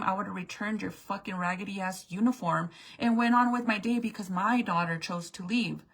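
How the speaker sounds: ordinary talk, nothing marked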